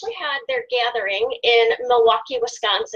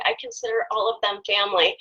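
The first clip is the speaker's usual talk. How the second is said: ordinary delivery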